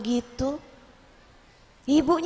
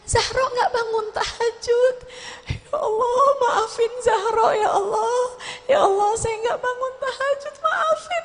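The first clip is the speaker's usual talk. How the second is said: sobbing prayer; 7 semitones higher, 3 dB quieter than usual. Retold or imitated. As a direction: voice breaking into tearful cries, rising high. Retelling Zahro weeping over missed tahajud. (doa sambil menangis tersedu-sedu)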